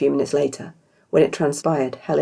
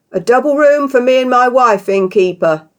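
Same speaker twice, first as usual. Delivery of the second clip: big booming voice, higher and much louder; 9 semitones higher, 9 dB louder than usual. Acted. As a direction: boom it out like a pompous husband. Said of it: "Beardy boomed".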